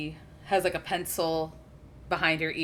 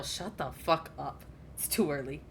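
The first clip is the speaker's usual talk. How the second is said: grumpy inner retort; 2 semitones lower, 5 dB quieter than usual. Acted. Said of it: "having an internal".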